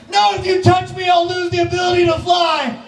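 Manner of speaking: very high, frantic yell; terrified butterfly. Pretend. Butterfly refusing the princess's finger, frantic high yell. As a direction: shriek the refusal.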